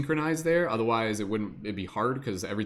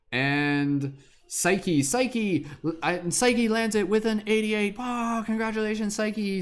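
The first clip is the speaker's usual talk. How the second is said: excited announcement; 8 semitones higher, slower than usual. Real, not acted. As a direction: pitch jumps high, calling the winner. Winner announced.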